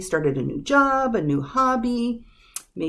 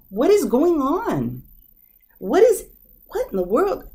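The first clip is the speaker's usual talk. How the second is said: negative questioning; 7 semitones higher, 4 dB louder than usual. Shown as a demonstration. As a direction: demand it of someone who made a mess. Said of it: "a little negative to the person".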